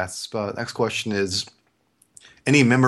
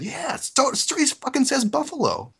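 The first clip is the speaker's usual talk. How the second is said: indignant certainty. Retold or imitated. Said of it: "I said".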